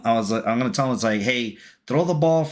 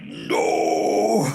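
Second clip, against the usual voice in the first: high, drawn-out wailing "no"; mock dismayed cry; 7 semitones higher than usual. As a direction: replay the dismay in one long high cry. Mock dismay as the ball flies off.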